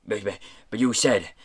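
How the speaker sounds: sputtering objection; stammered protest. A narrator's reading. Pirate guard protesting to the boss, stammering. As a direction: trip over the first word while protesting. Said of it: "Mags protested".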